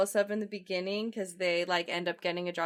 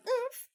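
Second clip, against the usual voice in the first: short, high grunt of pain; pained oof. Retold or imitated. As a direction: let out a mock "oof" as the back goes. Acting out golfer hurting back.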